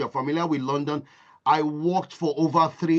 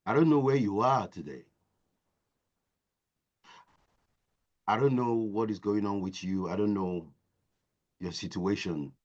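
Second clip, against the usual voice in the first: soft sincere address; 10 semitones lower, 7 dB quieter than usual. Real, not acted. Speaker softly addresses the audience.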